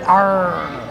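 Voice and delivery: short pirate arr; pirate growl. Shown as a demonstration. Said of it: "Can you give me your best pirate?".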